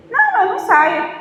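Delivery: high, loud and stubborn, refusing to budge; defiant refusal. Retold or imitated. To the spirit ordering her out, a defiant loud refusal. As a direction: plant your feet and refuse.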